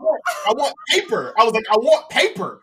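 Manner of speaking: going off on him. Retold or imitated. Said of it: "I start going off on this dude".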